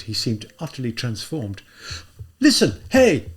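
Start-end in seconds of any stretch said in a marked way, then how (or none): 2.4-3.3 high, loud call after him; shout. Acted. Raskolnikov shouting after the policeman, loud high yell. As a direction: yell across the street to stop him. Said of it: "he shouted after the man".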